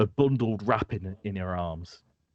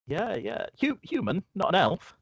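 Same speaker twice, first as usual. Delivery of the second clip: much higher, nodding along; high-pitched Marion; 10 semitones higher than usual. Acted. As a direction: keep her high voice going. Marion still talking.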